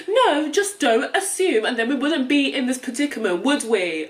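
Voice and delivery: imagined cheeky comeback to the ignorant; sassy retort. Retold or imitated. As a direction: tell them off with attitude. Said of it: "they're like".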